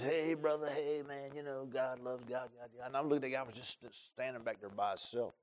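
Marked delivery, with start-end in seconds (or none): 0.0-2.9 soft, fading imitation of pious greetings; mock churchgoer chatter. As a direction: mutter the empty "brother" talk mockingly.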